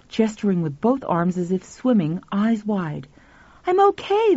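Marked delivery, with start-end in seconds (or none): 3.7-4.4 put-on high-pitched young boy voice; high boy's voice; dreamlike boy. A narrator's reading. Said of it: "the boy said without moving his lips".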